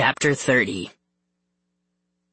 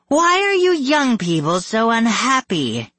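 high, singsong reproach; high-pitched theatrical questioning; demanding villainess; 10 semitones higher, 4 dB louder than usual. A narrator's reading. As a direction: demand it as a wicked mother. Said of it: "Margot demanded".

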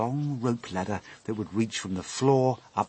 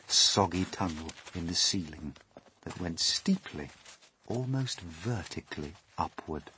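ordinary delivery, nothing marked

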